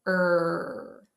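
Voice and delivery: sustained, isolated "Er"; R sound. Shown as a demonstration. Teacher shows class the tongue position, held R sound. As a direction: hold "Er" with the tongue pulled back. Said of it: "the R sound".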